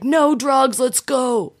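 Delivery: lightly voiced tough-woman boast, pitched high. Mother-to-be in labor boasting to herself, mock bold. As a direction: play a bold woman refusing the epidural. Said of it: "that strong woman who was like".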